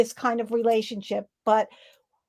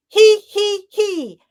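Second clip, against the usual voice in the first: higher, loud he he he from the throat; high throat giggle; 9 semitones higher, 7 dB louder, much slower than usual. Shown as a demonstration. Coach showing the throat laugh sound. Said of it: "where we're always talking".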